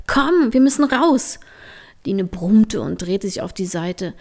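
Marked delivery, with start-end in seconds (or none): 0.1-1.4 eager. Acted. Trini eager to go out.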